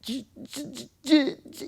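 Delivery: high, strained stuck syllables; choked, high-pitched stutter, acting out paralysis; stammering, held down. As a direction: struggle to force out the first sound of a word, breath catching. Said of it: "I could only get the J and the E out".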